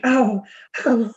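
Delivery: a light send-up of a sultry starlet; Jennifer Tilly. Retold or imitated. Teasing her co-host by mimicking her attempt as Jennifer Tilly. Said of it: "like a Jennifer Tilly".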